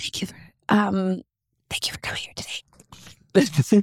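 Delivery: slow soft ASMR whisper. Shown as a demonstration. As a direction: breathe the words close to the mic.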